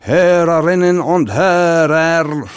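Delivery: loud, slow, high theatrical German stage diction; German stage pronunciation. Retold or imitated. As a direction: call it out like an old radio announcer in German stage pronunciation. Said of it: "laut ins Radio reingerufen in deutscher Bühnenaussprache".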